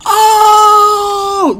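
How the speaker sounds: long, high, loud crowd "oh"; retold scream. Everybody reacting to the slap, retold as a long scream. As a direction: hold a high, loud yell of shock. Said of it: "I screamed".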